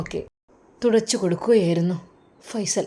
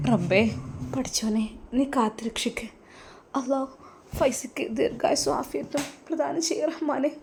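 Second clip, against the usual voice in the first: prayer; 5 semitones higher than usual. Acted. Mother prays to God.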